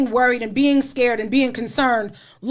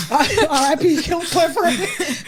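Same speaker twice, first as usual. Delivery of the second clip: laughing mock eulogy; 4 semitones higher, much slower than usual.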